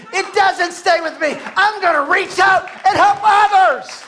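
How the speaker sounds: high, loud rallying shout; climactic preaching. Acted. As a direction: build to a shouted vow and stretch out the words.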